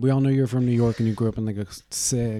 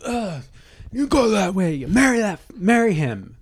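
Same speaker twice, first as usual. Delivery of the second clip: high, louder, pushy shove-the-bride voice; mock bossy pusher; 8 semitones higher, 3 dB louder than usual. Mimicking a family pushing the bride toward her husband, bossy and high. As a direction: herd her to the altar with a groan. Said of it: "the bride is kinda trained to be".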